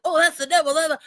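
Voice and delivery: high, mock-dramatic warning; mock alarmist. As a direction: parody a fearful zealot. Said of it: "these people are like".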